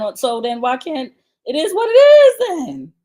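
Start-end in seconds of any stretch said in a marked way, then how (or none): 1.5-2.9 high, loud mocking squeal, drawn out slowly. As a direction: squeal his line back at him in a shrill parody. Mocking his catchphrase, high and loud.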